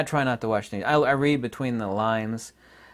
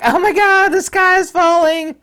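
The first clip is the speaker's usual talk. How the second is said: high, loud mock panic, much higher; mocking fearful woman; 11 dB louder than usual. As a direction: ham up a frightened official crying doom. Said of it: "She literally".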